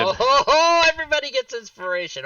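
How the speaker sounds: high, loud, fast delight; real excited whoop. Real, not acted. Player cheers inspiration for the whole party, excited whoop.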